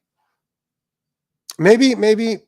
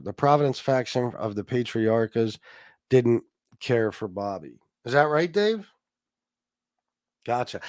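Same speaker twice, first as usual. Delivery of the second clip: ordinary talk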